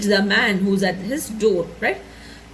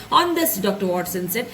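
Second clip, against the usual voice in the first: normal talking voice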